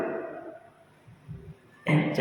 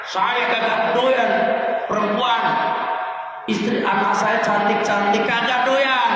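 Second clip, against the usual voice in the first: mocking mob reply; 9 semitones higher than usual. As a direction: sneer it back at Lot. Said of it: "Begitu, komedan".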